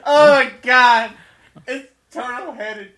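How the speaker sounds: laughing. Acted. Joking about needing the toilet.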